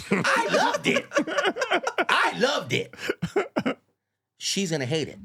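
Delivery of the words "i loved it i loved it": high, laughing delight. Real, not acted. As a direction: crack up while saying it. Laughing at Liz's failure.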